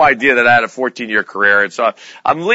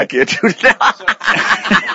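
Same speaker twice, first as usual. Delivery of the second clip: laughing through a mouthful of coffee; 9 semitones higher, much slower than usual. Real, not acted. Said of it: "I got a mouthful of coffee".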